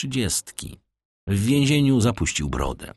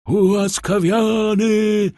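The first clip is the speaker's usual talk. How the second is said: high, drawn-out loud cry; crowd's shout; 14 semitones higher, 5 dB louder, slower than usual. A narrator's reading. People at the execution echo the pardon, shouting. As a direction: shout it as a crowd, stretching the word. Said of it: "zakrzyknął lud".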